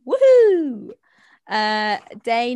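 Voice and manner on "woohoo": high loud whoop; excited cheer. Real, not acted. Cheering own driving theory.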